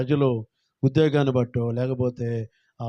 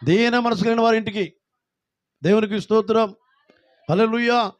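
higher and louder, leading the crowd; loud call-out; 7 semitones higher, 4 dB louder than usual. Shown as a demonstration. Preacher leading congregation in unison, loud call. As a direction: belt it out for all to repeat. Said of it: "గట్టిగా చెప్పండి అందరూ కలిసి".

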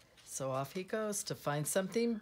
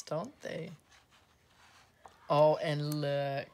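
plain reading voice

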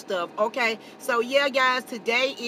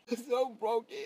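much higher, loud wailing fake sob; fake crying; 9 semitones higher, 6 dB louder, slower than usual. Vlogger fake-sobbing that Starbucks is closed. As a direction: howl it like a melodramatic breakdown. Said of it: "that was an act".

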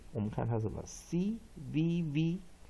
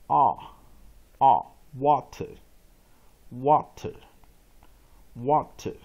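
water drawn out very slowly and louder; slow loud drill; 8 dB louder, much slower than usual. Shown as a demonstration. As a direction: repeat water slowly for the class. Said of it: "teacher drills the word".

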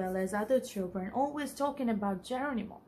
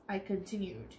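story narration; 3 semitones lower, 4 dB quieter than usual. A narrator's reading. Teacher reading a storybook aloud to the children, clear and lively.

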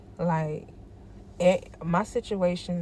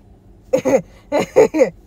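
loud laugh; 7 semitones higher, 14 dB louder than usual. Real, not acted. Bursts out laughing.

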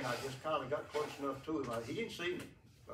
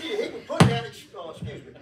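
ordinary talk, nothing marked

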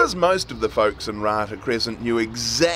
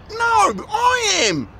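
high, slow witch voice; a sly witch insisting, pitched high; 4 dB louder, slower than usual. A narrator's reading. Impostor witch claims identity.

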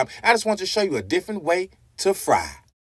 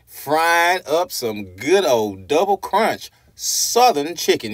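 ordinary talk

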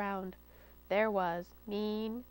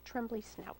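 whispered; 11 dB quieter than usual. A narrator's reading. Narrator to children, a soft whisper. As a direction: whisper the trembly snout softly.

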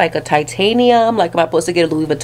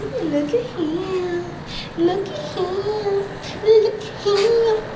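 very high, slow sing-song cooing; 11 semitones higher, 7 dB quieter, much slower than usual. Real, not acted. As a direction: croon over the cute dog, drawn out. Showing off the cute dog.

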